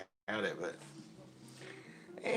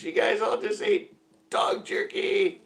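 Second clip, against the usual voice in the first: mocking reveal; 11 semitones higher, 5 dB louder, slower than usual. Friend reveals the bag's contents.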